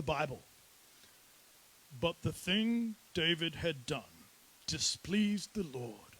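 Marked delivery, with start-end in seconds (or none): 2.0-6.2 measured, solemn English-accented reading. Shown as a demonstration. Preacher reads an understated Bible verse, English accent. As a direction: read it gravely in a put-on English accent. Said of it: "I don't know why it needs an English accent".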